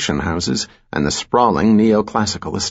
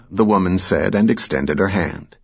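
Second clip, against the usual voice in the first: ordinary delivery, nothing marked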